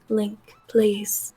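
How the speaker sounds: soft unvoiced plea; Zelda whisper. Shown as a demonstration. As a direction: breathe it like a pleading princess. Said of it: "She talks kinda like this".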